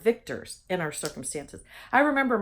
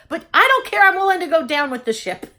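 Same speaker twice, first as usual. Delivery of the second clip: much louder and higher, cheeky defiance; playful defiance; 7 semitones higher, 9 dB louder than usual. Real, not acted. Joking she'll hug anyway, loud and playfully defiant. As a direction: shrug it off with a loud grin.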